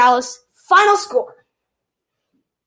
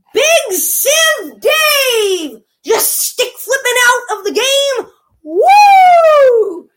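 mocking hype; 7 semitones higher, 4 dB louder, much slower than usual. Mocking the new goalie.

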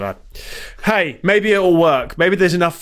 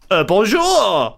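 slow, drawn-out Montreal French greeting; Montreal French accent; 4 semitones higher, much slower than usual. Retold or imitated. How they greet him flying into Montreal, French Canadian accent. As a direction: imitate a French-speaking Montrealer, slowly. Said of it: "Apart from in Montreal".

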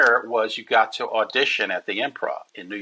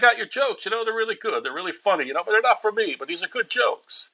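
Rodney Dangerfield impression; 11 semitones higher, faster than usual. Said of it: "He's like".

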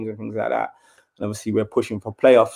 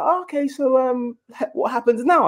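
mum's voice. Retold or imitated. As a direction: ask it like a puzzled mum. Mum voiced in story.

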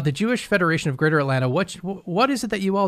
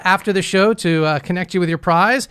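ordinary talk, nothing marked

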